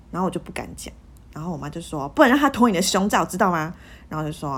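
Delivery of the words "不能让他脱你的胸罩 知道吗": imitating mom, louder and higher; mom's warning. Mom warning her daughter about the boyfriend, mock raised voice. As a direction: raise the voice like a worried mother.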